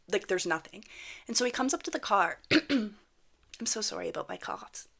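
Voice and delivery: ordinary talk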